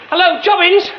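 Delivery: desperate high shout for help. Acted. Trapped man yelling for Jobbins to help. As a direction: yell up from the well to be heard.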